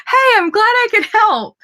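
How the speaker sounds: high, loud, cheerful burst; delighted. Real, not acted. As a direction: pipe up brightly to reassure a viewer. Cheerful reply to viewer comment.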